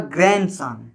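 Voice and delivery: ग्रैंडसन said as "gran-san", no D; ग्रैंडसन with n running straight into s; silent D. Shown as a demonstration. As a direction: drop the D in ग्रैंडसन. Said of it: "डी को silent कर दिया जाता है".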